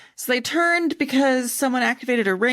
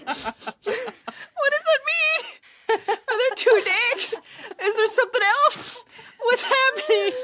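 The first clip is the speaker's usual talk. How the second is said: high, laughing disbelief; breaking character giggling; 13 semitones higher than usual. Real, not acted. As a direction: squeal through helpless giggles. Player laughing at two punches.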